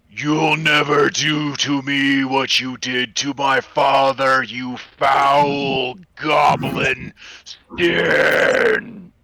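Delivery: weak, slow gravelly voice, loud. Acted. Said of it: "low, gravelly voice that is somehow not as strong and vibrant".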